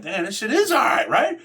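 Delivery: high, amazed reaction to the new unit; wide-eyed surprise, pitch up high; sounding impressed by carpet and seats. Retold or imitated.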